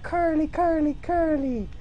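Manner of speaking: high sing-song curly chant; mock character voice. Voicing the drawn face admiring its curls, high sing-song. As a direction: squeak it out as the drawing. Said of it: "he's like".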